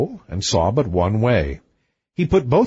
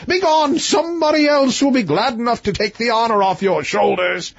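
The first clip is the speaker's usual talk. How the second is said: raised, lordly king voice; high-voiced storybook king; king's voice; 9 semitones higher than usual. A narrator's reading. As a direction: pitch the king up, grand and grudging. King ordering prince away.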